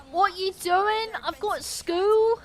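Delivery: high, drawn-out mock British accent; put-on regional British accent. Streamer mimicking local accents in chat about them, high mock British accent.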